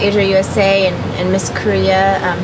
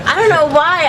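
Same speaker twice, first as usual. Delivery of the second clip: laughing; 7 semitones higher than usual. Real, not acted. Laughs at making-out question.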